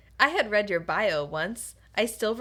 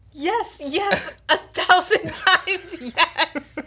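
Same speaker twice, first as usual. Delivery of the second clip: higher, laughing acceptance, the last words slow; overwhelmed with joy, laughing; overjoyed yes; 7 semitones higher, 6 dB louder, much slower than usual. Acted. Tanya accepts the proposal.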